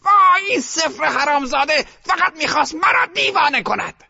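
put-on high, loud furious old lady; a grandmother cursing the wheel; scene: the old grandmother curses the zero, shouting; grandmother's angry shout. A narrator's reading. Grandmother rages at the roulette wheel.

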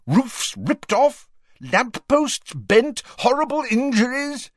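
slow, high-pitched angry list; outraged, spaced-out catalogue; barked outrage. A narrator's reading. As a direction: punch out each item, voice high. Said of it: "now positively stamping up and down".

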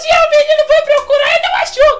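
high, loud squealing cartoon voice; Super Histérico impression. Shown as a demonstration. Drunk [name] doing Super Histérico for the audience, high shrieking voice. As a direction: shriek it like a hyper cartoon character. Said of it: "imitação do Super Histérico".

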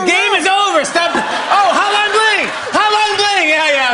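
high, loud triumphant yelling; excited shouting. Real, not acted. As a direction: shout the answer out. Shouting final guesses.

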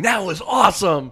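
loud, high mock enthusiasm, drawn out; imitating someone's overexcited shout; mock boast. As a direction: crow it slowly like a thrilled performer back from a match. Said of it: "they came back saying".

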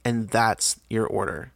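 normal talking voice

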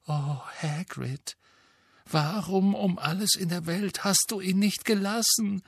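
put-on high, dismayed girl's voice; Hermine voice.

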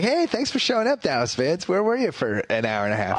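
mock-grateful, pitch jumping high at the start; sarcastic cheer at latecomers. Mocking the late-arriving Dallas fans.

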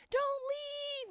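put-on high pleading kid voice; niece begging. Niece at the concert begging the group to stay. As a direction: beg them not to go like an excited little girl. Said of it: "she was like".

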